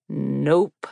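deep male voice. Acted. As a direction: drop it low and dry like a tall stranger. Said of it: "His voice was deep".

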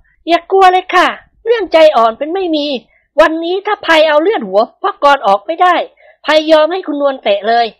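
high, firm woman's voice; a wife set on catching her husband; high-voiced wife, resolute and grim; determined young wife. A narrator's reading. Prapai vows to fight.

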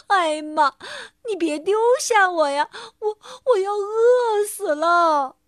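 loud, whining plea; pleading cry. A narrator's reading. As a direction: wail not to be left behind. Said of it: "韦伯叫道".

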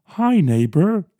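sing-song neighbourly hello, drawn out; Mister Rogers voice. Retold or imitated. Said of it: "Mister Rogers".

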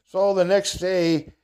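ordinary talk, nothing marked

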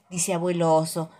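ordinary delivery, nothing marked

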